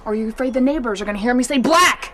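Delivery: loud taunt building to a shout. Acted. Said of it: "lower your voice".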